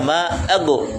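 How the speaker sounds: AGU with a bouncing release; echoing bounce. Shown as a demonstration. Teacher showing another forbidden reading, bounced AGU. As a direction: bounce the G of AGU into an extra vowel. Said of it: "Atau qalqalah".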